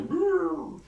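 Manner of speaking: high, loud brrr of a spinning head; confused brrr. Retold or imitated. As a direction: make a silly confused noise. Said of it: "make your head go".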